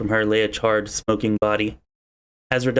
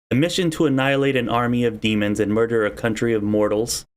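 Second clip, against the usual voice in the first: ordinary talk, nothing marked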